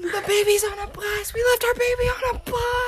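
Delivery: high panicked yell; panicked shout. Retold or imitated. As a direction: shriek it in mock panic. Acting out panicked Friends scene.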